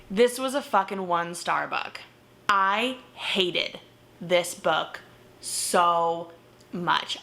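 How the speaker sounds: slow, heavy stress on every word; emphatic disgust. Real, not acted. Reviewer tells viewers she hated the book, slow and emphatic. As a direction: drag each word out in disgust.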